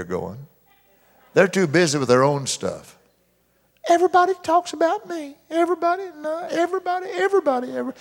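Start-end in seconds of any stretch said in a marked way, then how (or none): 3.9-7.9 high, fast complaining voice; mock a sulky churchgoer; much higher whining imitation; whiny self-pity mimic. Mocking a self-pitying person.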